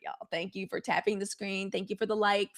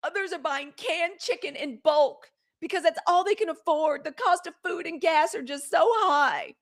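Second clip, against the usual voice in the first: higher, lightly imitating her earnest listing; mock pundit; 7 semitones higher than usual. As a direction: play her concerned tone, a bit mocking. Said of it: "She continued".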